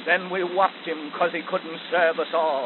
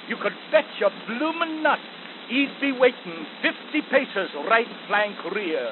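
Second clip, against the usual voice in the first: ordinary delivery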